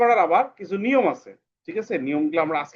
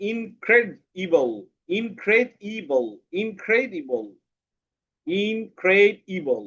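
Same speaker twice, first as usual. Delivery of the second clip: "Incredible" slow and higher, each syllable drawn out; 3 semitones higher, much slower than usual. Shown as a demonstration. Teacher asking a pupil to count, "incredible" stretched by syllable. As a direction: repeat "incredible" in separated beats. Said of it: "কয়টা syllable আছে বলো তো".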